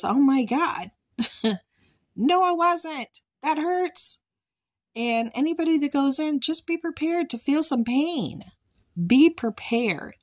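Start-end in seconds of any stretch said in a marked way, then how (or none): 0.0-7.3 exasperated. Real, not acted. Exclaiming about the pain.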